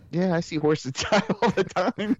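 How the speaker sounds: daughter imitated. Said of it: "She's like".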